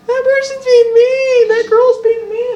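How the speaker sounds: put-on high, loud whiny child crying; crying little kid. Little kid crying to adults that someone is mean. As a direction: wail it like a hurt toddler.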